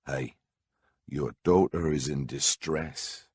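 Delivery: normal talking voice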